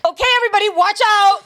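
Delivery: high, loud playful call to everyone; mock announcer shout to the field; joking warning. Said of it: "I'm gonna try and make a joke".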